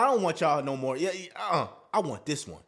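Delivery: mock woman dismissing her other suitors; girl brushing guys off. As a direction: quietly wave them away, she's picked one. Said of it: "she like".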